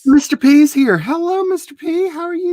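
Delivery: high, bright sing-song hello; high cheerful hello; excited greeting. Real, not acted. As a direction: welcome a friend warmly. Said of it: "greeting a chat viewer".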